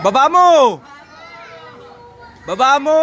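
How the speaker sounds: loud coach's command; shouting. Real, not acted. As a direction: bark it across the court. Coach yelling at player in drill.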